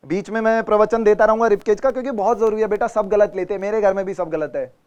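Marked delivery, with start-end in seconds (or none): none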